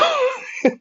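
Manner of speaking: squeaky high laugh of disbelief; shrieking high laugh. Real, not acted.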